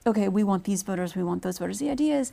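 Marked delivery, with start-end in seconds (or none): none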